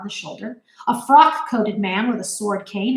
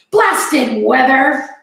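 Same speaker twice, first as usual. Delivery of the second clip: loud, slow angry growl; captain cursing the storm, growling; growled curse; 4 semitones higher, 7 dB louder, much slower than usual. A narrator's reading. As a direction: spit out the curse. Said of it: "he growled".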